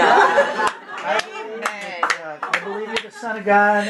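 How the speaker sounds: can barely hold back laughter; laughing through the prayer. Real, not acted. Father retelling son's first prayer, laughing while voicing it.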